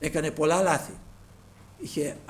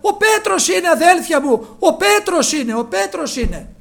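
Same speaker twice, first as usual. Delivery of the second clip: loud, high, breathless announcement; excited cry; 13 semitones higher, 14 dB louder than usual. Acted. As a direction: burst in shouting the good news. Rhoda crying out Peter is here. (ενθουσιασμένη κραυγή, δυνατά)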